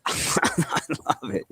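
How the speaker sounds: breathy laughing repeat. Real, not acted. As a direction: laugh through it slowly.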